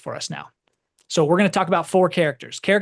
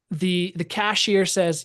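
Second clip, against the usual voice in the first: ordinary talk, nothing marked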